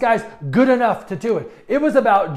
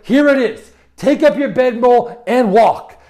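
Jesus's command rung out louder, slow and firm; 3 semitones higher, 8 dB louder, slower than usual. Acted. Jesus telling the sick man to rise, in a loud commanding voice. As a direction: proclaim it like a command.

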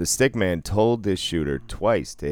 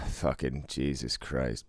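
low, much softer weary curse; exasperated groan; 5 semitones lower, 10 dB quieter, slower than usual. Real, not acted. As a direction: sigh it out, drained. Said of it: "exasperated sigh".

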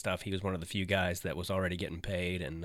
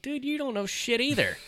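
much higher, exasperated mock complaint; annoyed quote. Retelling his complaint to his lost friend, high and exasperated. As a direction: whine it at your useless tour guide. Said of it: "I'm like".

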